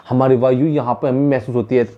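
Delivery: usual speaking voice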